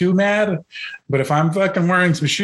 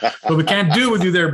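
ordinary talk

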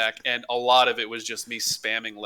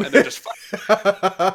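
laughing disbelief; 5 semitones higher, 4 dB louder, much slower than usual. Real, not acted.

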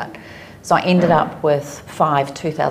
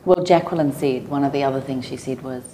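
usual speaking voice

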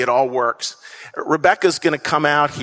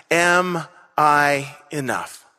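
slow question; much slower than usual. Acted. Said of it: "the central question, slowed".

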